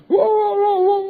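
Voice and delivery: high, loud battle whoops; war cry. Retold or imitated. Israel's soldiers shouting a battle cry, high and loud. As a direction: whoop like an army charging. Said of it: "membuat sorak perang".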